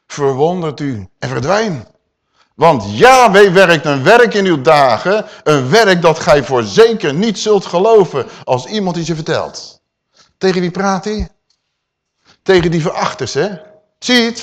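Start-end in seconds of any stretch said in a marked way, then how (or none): 2.6-9.8 forceful scripture preaching. Acted. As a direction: proclaim it to the scorners. (luid verkondigend preken)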